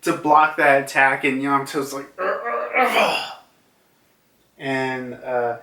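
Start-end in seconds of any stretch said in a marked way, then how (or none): 2.2-3.4 short, higher-pitched grunts of effort; strained grunts. Acted. Yamato blocking Kaido's attack, acted grunts of strain. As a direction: grunt as if taking a hit.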